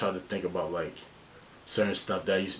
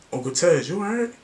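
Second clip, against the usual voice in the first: put-on high-pitched small child checking in; light, high kid voice lifting into a question; imitating little nephew; 11 semitones higher, 6 dB louder than usual. Young nephew checking on his uncle, high imitated child voice. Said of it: "since my nephew was, like, three, four years old".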